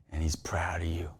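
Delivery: hushed, low, intimate assurance; tender whisper. Real, not acted. Preacher assuring listeners of God's pride, whispered. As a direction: let it sink to a heartfelt whisper.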